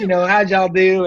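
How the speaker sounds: put-on folksy Arkansas accent, friendly; a good-old-boy Arkansas accent. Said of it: "I'm talking like this here".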